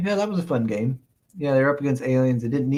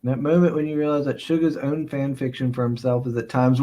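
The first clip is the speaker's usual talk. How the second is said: ordinary talk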